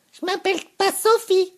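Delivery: put-on very high, loud girl's voice; frightened girl. A narrator's reading. The girl recoils from Vénou, startled high voice. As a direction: back away, protesting. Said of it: "dit-elle en reculant de quelques pas".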